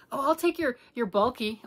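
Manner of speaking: high, loud, eager mock offer; acting like a friend pouncing on the bulky yarn; eager grab. To a friend offering yarn, a high loud eager grab. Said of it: "I'm like".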